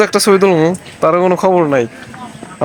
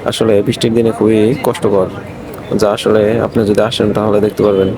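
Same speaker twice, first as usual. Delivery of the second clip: vlog narration; 9 semitones lower than usual. Acted. Addresses viewers about the trail.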